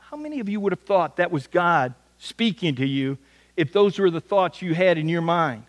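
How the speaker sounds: ordinary talk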